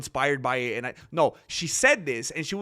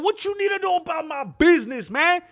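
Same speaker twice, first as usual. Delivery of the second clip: high, loud aggressive challenge; angry yell; 13 semitones higher, 4 dB louder than usual. Retold or imitated. Angry customer yelling at the telemarketer, high loud challenge. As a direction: shout him down like a picked fight. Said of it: "And he's like".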